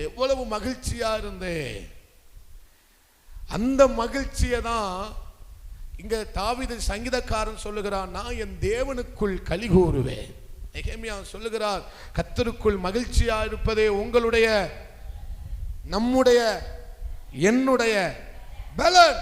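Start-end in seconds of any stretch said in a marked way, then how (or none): 3.5-17.9 loud scripture declaration with charged pauses; forceful preaching. Real, not acted. 18.8-19.2 high, explosive preacher's shout; loud shout. Real, not acted. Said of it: "அந்த பலனை சத்தமா சொல்லுங்க".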